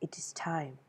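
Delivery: breathy, near-whispered solemn proclamation; Raiden style. Acted. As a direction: intone it in a hushed, ominous whisper like Raiden. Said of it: "come in Raiden style and be like".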